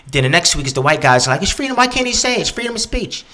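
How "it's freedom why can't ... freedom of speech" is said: a mock whine about free speech. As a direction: pitch way up to mock the indignant defenders.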